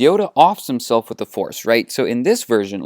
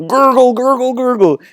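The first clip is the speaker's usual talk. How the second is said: loud, high gargled Wookiee warble; comic creature noise made of rolled nonsense syllables; Chewbacca; 12 semitones higher, 6 dB louder than usual. Acted. Chewie answers Han, in a loud warbling gargle. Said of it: "And Chewie's like".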